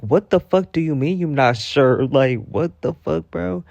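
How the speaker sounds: loud, high, exasperated outburst with a laugh; comic outrage, half laughing; pissed off. Retold or imitated. Viewer fuming at an interviewee's non-answer, pissed off and incredulous. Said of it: "that really pissed me off".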